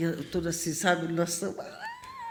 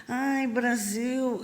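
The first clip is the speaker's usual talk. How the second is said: very slow, drawn-out; missing Brazil, drawn out; longing sigh; 5 semitones higher, much slower than usual. Retold or imitated. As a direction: stretch the longing.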